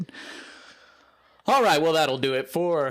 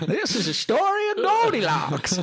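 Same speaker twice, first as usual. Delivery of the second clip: high, laughing prospector shout; old prospector voice. Pretend. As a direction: cackle through it like an old miner. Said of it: "The old prospector's telling us a story".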